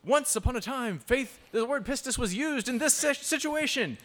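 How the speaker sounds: mock over-detailed lecturer. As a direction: drone on about trivia in a high voice. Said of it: "and then you".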